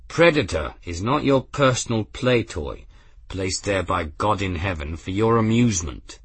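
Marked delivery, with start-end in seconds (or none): none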